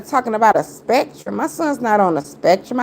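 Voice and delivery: usual speaking voice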